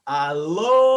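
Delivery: Aloha, loud and drawn out, ah-LOH-ha; Hawaiian greeting. Shown as a demonstration. Speaker opening a talk, greets the crowd with a booming Aloha. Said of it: "is they say".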